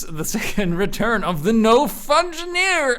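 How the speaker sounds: hyped-up showman's reveal; grand announcement. Acted. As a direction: build up to a long, high final word. Retakes the grand reveal after slip.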